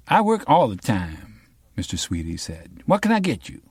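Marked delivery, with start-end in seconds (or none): 0.1-1.4 bar owner voice. Acted. Bar owner answers Raymond. 2.8-3.6 pitched high, breezy barkeep; high bar owner voice. Acted. Mister Sweety offering Raymond a drink, high and breezy.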